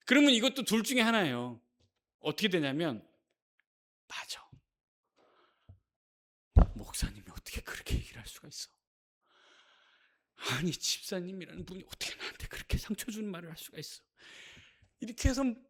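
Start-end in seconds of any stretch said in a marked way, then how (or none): 4.1-14.0 soft, wounded muttering, acted out; hurt and resentful. Believer wounded by pastor's words, quietly resentful. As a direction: brood quietly over a slight. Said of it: "막 서운해져요".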